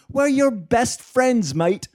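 high, slow mock Australian voice; stretched-out Australian impression; Will Anderson. Australian comedian claims friendship, mock Australian accent. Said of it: "according to Will Anderson".

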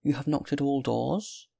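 high voice, rising to a question; questioning old woman. A narrator's reading. Good woman checks the man's claim, high questioning. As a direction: ask it high, lifting at the end.

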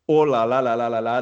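ringing French commentator exclamation. Acted. Host celebrates this glorious moment for French football, as French commentator. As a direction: let it roll out like a French commentator. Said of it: "that's my French commentator".

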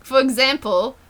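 reading aloud in an Australian accent. Shown as a demonstration. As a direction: read it out with an Australian twang. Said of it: "Australian accent for me just seems".

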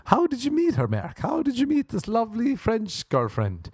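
high, sing-song priest question; Father Seamus voice. Retold or imitated. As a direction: quiz the boy like a curious priest. Voicing Father Seamus asking.

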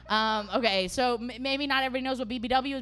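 ordinary talk

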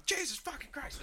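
high, muttered exasperation; mock exasperated staffer under his breath; exasperated curse. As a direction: let slip a fed-up curse off-mic. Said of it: "you hear him go".

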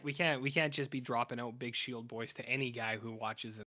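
ordinary talk

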